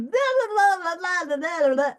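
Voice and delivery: mocking blah blah. Mocking endless partisan chatter.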